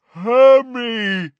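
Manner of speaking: high loud roaring giant. Acted. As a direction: roar the name like a giant. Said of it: "roared Grawp".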